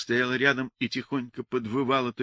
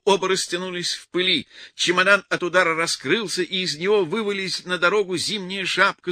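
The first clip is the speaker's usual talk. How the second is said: plain reading voice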